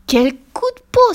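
high, loud, thrilled exclamation; delighted cry. A narrator's reading. Fifi to her friends, high, loud, thrilled. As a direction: shout it out with joy. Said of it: "s'écria-t-elle".

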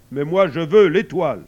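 forceful projected contradiction; insistent sun. Acted. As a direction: insist loudly in character as the sun. Narrator voices the sun.

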